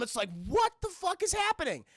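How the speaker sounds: normal talking voice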